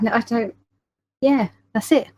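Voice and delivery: usual speaking voice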